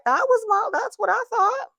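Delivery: squeaky high mocking own plan. Real, not acted. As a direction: pitch way up, poking fun at herself. Mocking her own naive plan.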